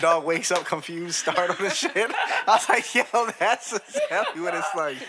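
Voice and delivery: high and loud, laughing through it; cracking up at his own story. Real, not acted. Laughing hard while telling the cat meme.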